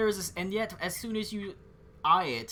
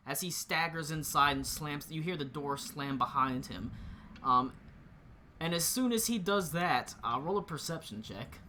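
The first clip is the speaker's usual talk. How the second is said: normal talking voice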